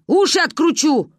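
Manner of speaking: put-on grandma threatening the girl from upstairs. A narrator's reading. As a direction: bark a mock threat.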